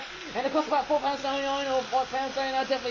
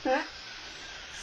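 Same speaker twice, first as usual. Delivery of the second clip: breathy laugh; 4 semitones higher, 7 dB quieter than usual. Real, not acted. Laughs at own word slip.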